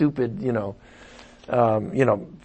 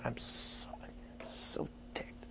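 hushed self-reproaching whisper; 5 semitones lower, 30 dB quieter than usual. Acted. Pastor voices a sinner scolding himself in a whisper. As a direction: breathe it out ashamed under your breath.

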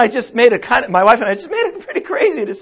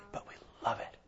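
breathy whisper, let them lean in; a secret shared with the congregation; whispered aside; 25 dB quieter, faster than usual. Real, not acted. Confiding aside about the move.

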